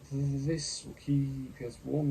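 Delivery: slow, high and loud; shivering sailor voice. A narrator's reading. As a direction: stretch it out through the cold. Shivering Sean's voice.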